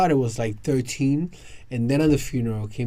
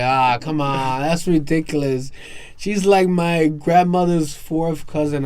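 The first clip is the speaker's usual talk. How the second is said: louder, drawn-out protest; exasperated protest; 5 semitones higher, 6 dB louder, slower than usual. Retold or imitated. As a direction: protest it slowly, fed up. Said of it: "I was like".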